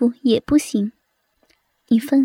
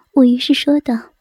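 ordinary delivery, nothing marked

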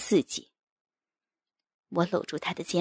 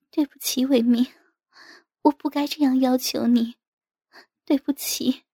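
high, remorseful wife's voice; apologetic wife; 7 semitones higher, 6 dB louder than usual. A narrator's reading. Wife apologising to her dejected husband, remorseful. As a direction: apologise earnestly in a higher voice. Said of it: "充满歉意地搂着我".

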